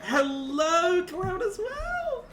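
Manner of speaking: very high, excited greeting; high-pitched voice. Real, not acted. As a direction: greet them in a squeaky high voice. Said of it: "my voice is going so high pitched".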